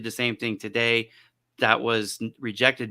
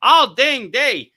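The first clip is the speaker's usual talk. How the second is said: imitating fed-up wife; 4 semitones higher, 9 dB louder than usual. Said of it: "And she's like".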